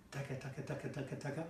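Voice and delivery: quiet rhythmic taka taka; soft taka taka. Shown as a demonstration. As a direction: softly chant taka taka with the beat. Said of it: "Do you hear that sound that you're making".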